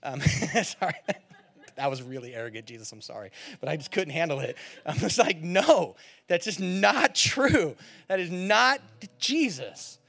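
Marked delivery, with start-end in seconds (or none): 5.4-10.0 loud, drawn-out emphatic refusal; indignant rejection. Retold or imitated. Preacher rebuts the commentaries he read, loud and indignant. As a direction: push back hard, stretching the key words.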